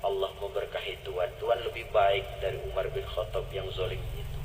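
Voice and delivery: low, soft voice for the tender scene; hushed storytelling. Acted. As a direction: draw listeners in with a near whisper. Quiet tender scene feeding family.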